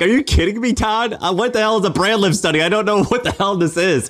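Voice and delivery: hammed-up outrage at the jargon; mock exasperated rant. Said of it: "I'm like".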